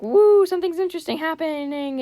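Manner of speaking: high, squealing excitement; high-pitched imitated thrill; excited whoop. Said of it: "I was just like".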